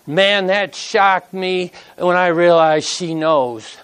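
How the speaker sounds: usual speaking voice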